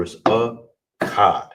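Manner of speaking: slow, joined "akkad", stress on the second syllable; said slow and whole. Shown as a demonstration. Teacher models "akkad" slowly for the class. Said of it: "pronounce it all together".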